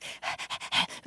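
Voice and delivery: quick unvoiced huffs of breath; short breathy dog pants; imitated dog panting. Shown as a demonstration. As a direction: pant like a hot dog, no voice. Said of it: "you know, panting".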